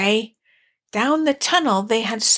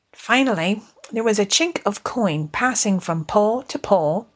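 ordinary delivery, nothing marked

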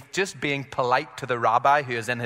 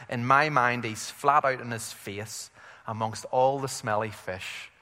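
normal talking voice

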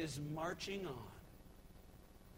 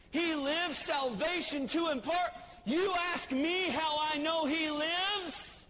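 full-force preaching; 6 semitones higher than usual. Acted. Preacher to congregation proclaiming the risen Christ, fervent. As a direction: preach it with everything you have.

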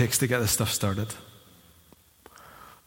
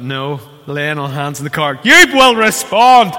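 commanding preacher; 10 dB louder than usual. Retold or imitated. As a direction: order the car to obey, like a healer. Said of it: "I thought".

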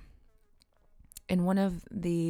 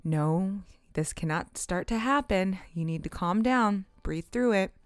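rising, louder self pep talk, a laugh in it; mock self pep talk; 4 semitones higher, 6 dB louder than usual. Coaching herself in a dream, building louder. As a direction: talk yourself down, then build to a loud cheer.

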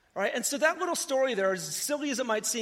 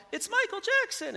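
higher, calling out across a crowd; mock shout of a bystander, pitched higher; retold yell; 11 semitones higher than usual. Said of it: "someone yelled".

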